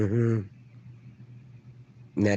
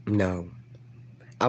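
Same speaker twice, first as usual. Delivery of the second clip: ordinary talk, nothing marked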